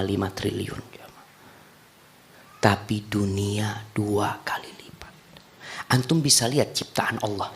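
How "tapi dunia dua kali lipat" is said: slow hushed whisper. Acted. Preacher whispering the reward to the congregation. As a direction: drop to a slow whisper for emphasis.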